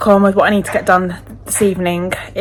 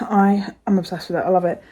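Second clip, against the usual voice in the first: normal talking voice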